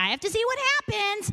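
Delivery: high-pitched, helpless insistence; mock protest pushed up high; insistent reply. Overruling the warning to go and look, insistent.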